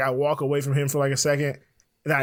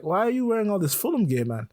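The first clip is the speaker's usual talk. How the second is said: higher-pitched, lightly put-on British accent; bad British accent; 6 semitones higher than usual. Stranger asking about football gear, bad British accent. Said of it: "I'm gonna do a bad British accent here".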